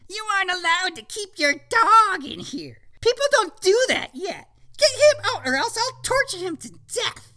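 witch voice. Acted. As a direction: threaten like a wicked witch.

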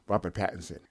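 normal talking voice

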